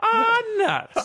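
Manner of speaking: high yelped complaint; old prospector. Pretend. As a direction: yelp it like a cartoon old-timer. Mock frustration exclamation.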